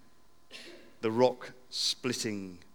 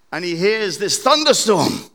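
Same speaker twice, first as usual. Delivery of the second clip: ordinary delivery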